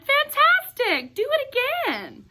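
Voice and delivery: excited shout. Acted. Elizabeth egging the dragon to fly again, shouting. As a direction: cheer him on at full voice. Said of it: "Elizabeth shouted".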